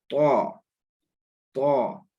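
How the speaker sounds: तौ held with rounded lips and heavy t; तौ, dark rounded vowel; thick heavy letter. Shown as a demonstration. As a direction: model तौ slowly as a full "taw". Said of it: "मुंह गोल हो रहा है और भारी आवाज".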